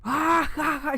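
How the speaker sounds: high, loud mock cackle; imitated loud laughter. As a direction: imitate a sister bursting out laughing. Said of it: "She starts fucking laughing through the phone loud as fuck".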